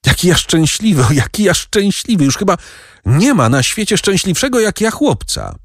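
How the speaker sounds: put-on very high, elated boyish voice; high-pitched childlike joy; overjoyed boy. A narrator's reading. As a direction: gush with happiness, pitched right up. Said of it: "Nie wiedział, co z sobą zrobić z radości".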